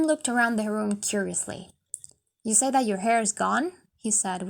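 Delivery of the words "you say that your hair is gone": ordinary delivery, nothing marked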